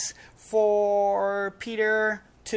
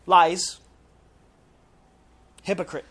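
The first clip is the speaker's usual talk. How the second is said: loud condemnation; 2 semitones lower, 6 dB louder than usual. Acted. Preacher condemns Peter's denial.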